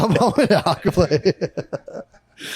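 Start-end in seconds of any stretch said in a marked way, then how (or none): 0.0-1.4 slow, laughing admission. Real, not acted. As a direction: laugh through it. Laughing at own joke.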